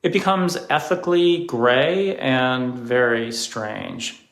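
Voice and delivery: ordinary delivery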